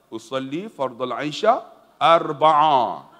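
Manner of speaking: mock prayer intonation. Uncle blurts out prayer words.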